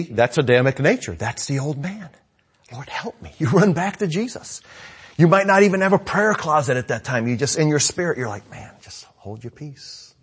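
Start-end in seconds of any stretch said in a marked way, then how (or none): 2.7-3.3 soft, under-the-breath prayer; hushed aside to God; quiet plea. Acted. Acts out a quick private prayer. 8.5-10.1 slow, near-whispered self-talk; very soft inner voice; hushed self-talk. Acted. Acts out talk in one's spirit.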